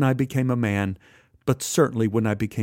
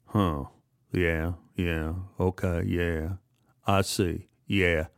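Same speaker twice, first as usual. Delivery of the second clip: muttering boss; 7 semitones lower, 6 dB quieter, slower than usual. Acted. Marvin reading invoice, muttering.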